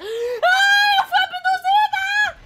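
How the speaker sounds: high, slow panicked shriek. Acted. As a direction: scream it out in alarm. Screaming about being abducted.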